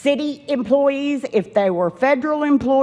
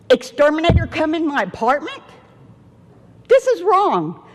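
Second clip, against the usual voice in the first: higher, indignant outburst; real outrage at intrusion; outraged; 5 semitones higher than usual. Real, not acted. Angry complaint.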